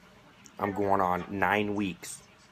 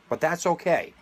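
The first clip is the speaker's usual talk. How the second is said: sarcastic; 8 semitones higher than usual. Real, not acted. Sarcastic jab at the government.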